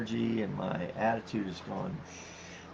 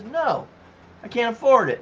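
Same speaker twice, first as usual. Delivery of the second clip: shoplifter's excuse; 12 semitones higher, 9 dB louder than usual. Retold or imitated. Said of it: "all they have to say is".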